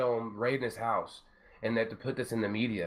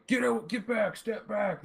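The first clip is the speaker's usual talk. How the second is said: much higher, barking cop orders; mock cop yelling at the crowd, high pitched; mock cop shout; 10 semitones higher, slower than usual. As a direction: shout them back like a riot cop, hammed up. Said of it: "the cops weren't all like".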